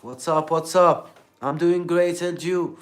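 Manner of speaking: loud, high practice greeting; talking to oneself. Retold or imitated. Re-enacting solo English practice, loud self-addressed greeting. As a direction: call it out like rehearsing a lively chat. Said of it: "I was talking to myself".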